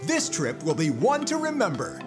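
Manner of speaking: normal talking voice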